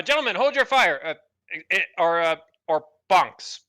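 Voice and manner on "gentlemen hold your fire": lieutenant command. Acted. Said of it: "please not bab them".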